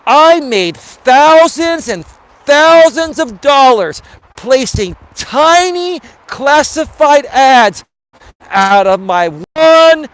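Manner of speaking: slow, loud, high emphatic pitchman; Don Lapre emphasis. Retold or imitated. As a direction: stretch and punch the key words hard. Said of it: "this dude's emphasizing his words. Like, this guy was talking slow".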